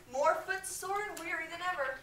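normal talking voice

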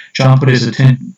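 ordinary delivery, nothing marked

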